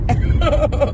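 muffled by palm over mouth; hand-covered laughter; laughing behind hand. Real, not acted. Seen 0: Palm presses over mouth while laughing; lowers by 1.5.

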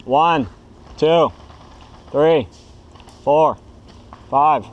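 steady loud count. Real, not acted. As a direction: call each number out loud. Counting down a revive aloud.

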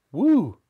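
a sharp, loud, high whew of relief; relieved whew. A narrator's reading. Girl blowing out relief as the witch leaves, loud whew. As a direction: blow out a big whew. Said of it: "letting out a long breath of relief".

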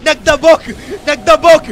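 high, loud yelling, the last word slow; excited yell. Real, not acted. Caster shouting to viewers over a tantrum play, loud. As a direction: shout it out like the crowd is roaring.

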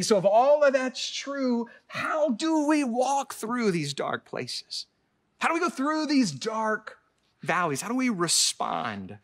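high, loud and slow, pleading with the congregation; preaching at full force. Acted. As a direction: drive each question home from the pulpit.

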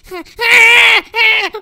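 frightened yelps of panic; scared screaming. Acted. Villager startled by the TV man, screaming in fright. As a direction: scream out in terror at the sight.

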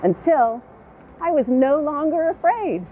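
gentle storybook read-aloud with long pauses; unhurried children's story narration. A narrator's reading. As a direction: read it like a bedtime picture book. Reads picture book aloud.

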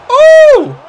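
very high, very loud shriek; shocked yell. Real, not acted. Gamer watching a shot fly close, high shriek. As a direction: scream in shock at the near miss. Near miss in match.

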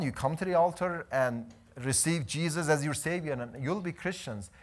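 normal talking voice

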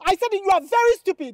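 angry insult. Acted. Girl insulting the suitor, angry.

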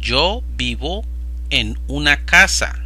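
ordinary talk, nothing marked

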